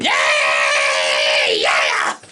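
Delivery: overexcited friend whooping; excited cheer. Retold or imitated. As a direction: cheer like she can't contain herself. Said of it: "always excited".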